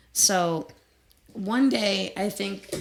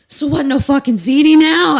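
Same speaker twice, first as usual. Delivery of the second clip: very loud, high mock yell; imitated shout; 9 semitones higher, 17 dB louder than usual. Bandmate quotes The Sopranos onstage, shouting over the music. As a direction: holler it like a bandmate in your ear. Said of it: "shouts me in my ear".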